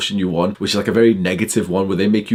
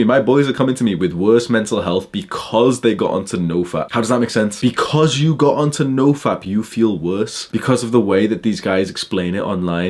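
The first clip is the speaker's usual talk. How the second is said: ordinary talk, nothing marked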